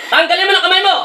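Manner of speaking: real exorcist's command; forceful command. Real, not acted. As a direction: order it off with pitch way up. Orders spirit to take it off.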